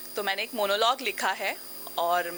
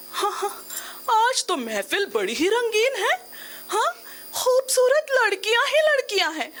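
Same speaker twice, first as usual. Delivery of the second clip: high, jovial banter swelling louder; teasing friend; 7 dB louder than usual. Acted. Friend jokes before everyone about pretty girls, teasing banter. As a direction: play a man joking for the crowd. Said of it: "ठिठोली करते हुए कहते हैं".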